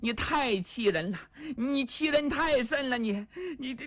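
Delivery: acted tearful wail, stammering off; crying in anger. Woman smashing furniture after his refusal, crying angrily. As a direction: sob out the complaint as it climbs. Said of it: "一边周一边哭啊".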